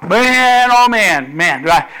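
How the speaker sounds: loud, high enthusiastic shout; crowd shouting. Acted. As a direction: shout it like a fired-up crowd pledging loyalty. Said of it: "all the people shouted".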